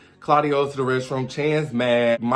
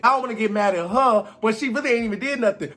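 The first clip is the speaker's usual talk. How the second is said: ordinary talk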